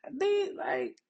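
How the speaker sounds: high, teasing squeal; mock brush-off. Mom retelling how she teased her daughter, high mock voice. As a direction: playfully shoo the kid away. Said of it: "I'm like".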